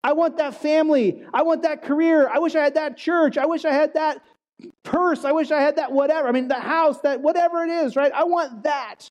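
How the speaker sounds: mock-envious social media scroller; envious wanting. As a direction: whine like someone jealous of others' gifts. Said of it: "we say".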